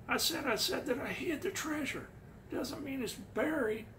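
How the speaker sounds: put-on winded, breathless old man voice; Fenn impression. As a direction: talk like you're completely out of breath. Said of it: "It's my Fenn impression".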